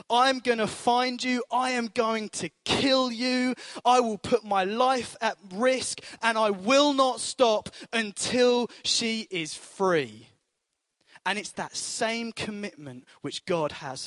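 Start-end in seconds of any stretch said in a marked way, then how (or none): 0.1-10.3 determined vow. Retold or imitated. Father's vow retold.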